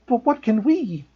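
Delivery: high, breathless gasp; frightened girl gasping. A narrator's reading. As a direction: catch your breath in alarm. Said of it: "Hermione gasped".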